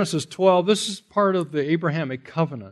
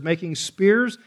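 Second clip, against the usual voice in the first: ordinary talk, nothing marked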